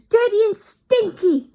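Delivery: a crowd getting worked up; crying out, worked up. Acted. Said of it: "cried the audience".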